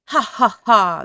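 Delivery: loud, hammed-up evil laugh; villain laugh. A narrator's reading. Hungry wolf laughing at the trapped pigs, loud and evil. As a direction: cackle like a storybook villain. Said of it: "laughed the hungry wolf".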